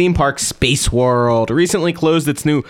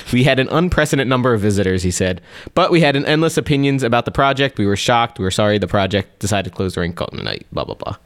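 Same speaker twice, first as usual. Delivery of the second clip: fast dismissive reading; 2 semitones lower, faster than usual. Acted. Reading a quote, rushing.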